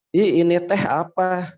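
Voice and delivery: lilting Sundanese-style question; lightly acted Sundanese logat; Sundanese speaker. Sundanese asking a Javanese person what this is, Sundanese accent. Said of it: "saya orang Sunda nih".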